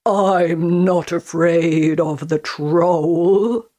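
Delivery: gruff big goat, unafraid; big billy goat voice. A narrator's reading. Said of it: "the biggest billy goat said".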